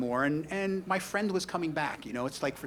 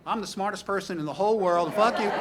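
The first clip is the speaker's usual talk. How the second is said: put-on drunk rant; 5 semitones higher, 5 dB louder than usual. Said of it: "What did she do?".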